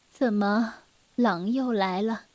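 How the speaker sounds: soft, low questioning; drowsy mother. Acted. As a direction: ask quietly, just woken. Sleepy mother asks child. (妈妈睡意未消地低声问)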